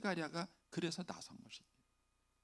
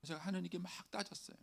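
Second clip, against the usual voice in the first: ordinary talk, nothing marked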